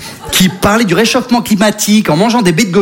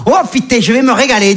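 a cheerful Marseille bear, a little higher; Marseille accent; 4 semitones higher than usual. Acted. Polar bear with a Marseille accent enjoying the sun. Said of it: "l'accent marseillais".

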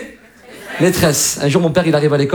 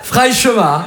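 Marseille flirt. Acted. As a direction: lay on a Marseille-accented pick-up. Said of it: "une fille qui se fait draguer à Marseille".

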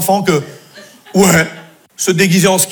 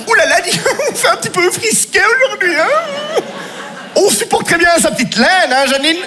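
high, mannered chatter about the chill; prissy bourgeois voice; 9 semitones higher than usual. Retold or imitated. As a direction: play a fussy lady at the cold. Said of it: "le froid qui te fait". (voix haut perchée de bourgeoise frileuse)

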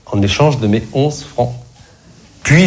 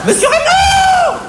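shrieking cashier; slower than usual. Acted. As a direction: scream for the boss across the store. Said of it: "hurla-t-elle". (appel hurlé, très aigu et lent)

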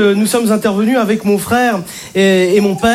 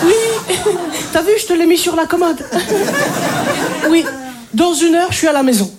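higher, sweet, giggly submission; meek boyfriend; 6 semitones higher than usual. Acted. A man on the phone to his girlfriend, giggling and meek. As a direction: coo like a tamed boyfriend. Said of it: "Je vais vous montrer".

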